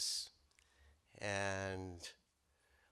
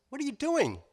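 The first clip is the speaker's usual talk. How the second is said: much higher, louder hyena question; 6 dB louder than usual. Acted. Hyena asking the rabbit what he is doing, high pitched. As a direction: squeak it like a nosy hyena.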